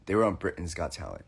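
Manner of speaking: quiet fan reply. Retold or imitated. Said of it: "And they go".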